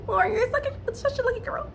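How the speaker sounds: very high, emotional and trembling; teary gushing. Real, not acted. Praising Lauren's lucky love, teary and high. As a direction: gush at her like you're near tears.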